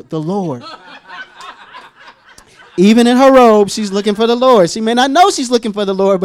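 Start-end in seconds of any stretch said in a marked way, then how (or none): 2.8-6.2 loud, impassioned pulpit cry; raised, forceful preacher delivery; emphatic preaching. Real, not acted. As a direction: drive the point home at full voice. Preacher drives home the point.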